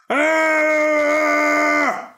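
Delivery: high loud shout; falling giant's cry. Acted. Giant falls from the beanstalk, loud high cry. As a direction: cry out as you fall. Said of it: "cried the giant".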